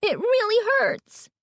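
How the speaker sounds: very high, drawn-out cry; slow, high-pitched agony; pained cry. A narrator's reading. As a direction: wail that it hurts. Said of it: "Carly Beth cried".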